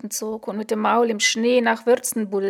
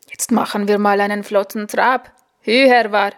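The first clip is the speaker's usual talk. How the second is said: sing-song and louder; fluting call; 6 dB louder than usual. A narrator's reading. Instructor fluting "Hü, Herr War" to urge the horse on. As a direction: sweetly coax the horse into a trot. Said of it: "flötete Rambo Flottmann".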